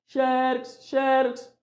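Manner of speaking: "Xerox" called high, with XE held long; calling-out stress demo. Shown as a demonstration. Teacher shows correct pronunciation, calling "Xerox" high with long XE. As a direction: call "Xerox" like a pet's name, stretching XE. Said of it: "a pronúncia correta é assim".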